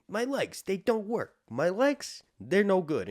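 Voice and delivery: put-on high-pitched whine of a needy kid; whiny Bran voice. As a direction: beg like a crippled boy. Bran complaining about his legs.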